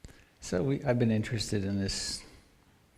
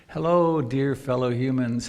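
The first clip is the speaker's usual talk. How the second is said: hearty acted greeting; 5 dB louder than usual. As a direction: call out a big greeting to everyone. Acting out a greeting.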